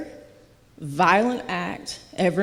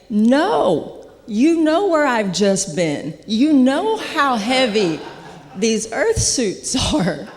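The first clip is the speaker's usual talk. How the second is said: loud, higher-pitched mock objection; acted protest; 5 semitones higher, 5 dB louder, slower than usual. Revived patient silently answering the medic, in loud mock protest. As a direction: protest with big emphasis, stretching it out.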